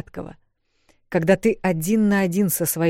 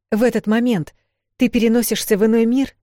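urgent question; 6 semitones higher, 6 dB louder than usual. A narrator's reading. Urgent question about teleporting.

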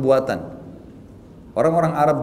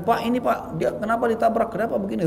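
higher-pitched, fussing accusation; imitated commotion; 7 semitones higher than usual. Stranger confronting the driver after a crash, imitated fussy accusation. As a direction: mimic an agitated bystander making a fuss.

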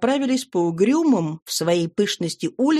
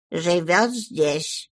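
slow voice out of the darkness; disembodied voice; 2 semitones lower, much slower than usual. A narrator's reading. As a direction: answer slowly, unseen, from the dark. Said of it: "Голос из темноты неожиданно ответил".

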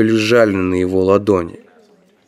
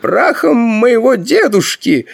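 slow, very high-pitched astonishment; amazed exclamation; 14 semitones higher than usual. A narrator's reading. Grand Master hears of offering gold to ancestors, amazed exclamation. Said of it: "изумлённо воскликнул верховный магистр".

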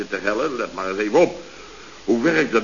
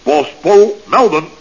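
luide oproep; 6 semitones higher, 9 dB louder, slower than usual. Acted. Roept Pol op via zender.

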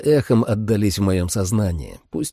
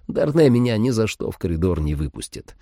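plain reading voice

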